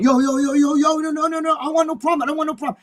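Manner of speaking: high, panicky imitation of frightened pleading; scared backing down. Big-mouth kid facing his rival, mock-panicked high pleading. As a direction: babble it high, imitating someone desperate to avoid trouble. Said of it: "was scared of him".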